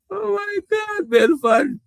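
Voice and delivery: "Oh my God" shot up very high; surprised outburst. Real, not acted. Host spotting Belfant's comment, sudden high surprise. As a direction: squeal it in sudden disbelief. Reacting to viewer's pick.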